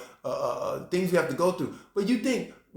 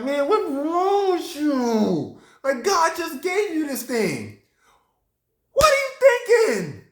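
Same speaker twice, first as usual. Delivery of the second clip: high-pitched rebuke building to a shout; exasperated scolding; 8 semitones higher than usual. Acted. Preacher confronting Jeroboam over God's gift, exasperated.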